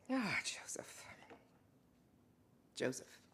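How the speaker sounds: whisper. Acted. Tender aside to Joseph.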